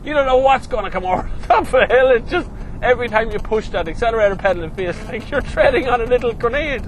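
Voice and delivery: high, loud and rushed with exhilaration; thrilled excitement. Real, not acted. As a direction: gabble excitedly over a speeding car. Thrilled driver describes flat-out acceleration.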